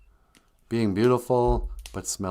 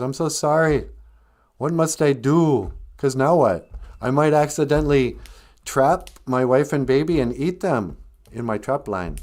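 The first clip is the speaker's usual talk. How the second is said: higher, louder acted plea; hammed-up remorseful pleading; pleading hunter; 5 semitones higher, 6 dB louder than usual. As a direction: beg for guidance in distress. Crying hunter pleads with the spirit.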